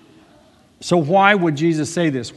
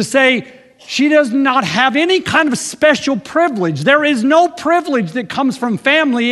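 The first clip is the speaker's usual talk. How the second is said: fervent preaching; 9 semitones higher, 5 dB louder than usual. Acted. Preaching at full force.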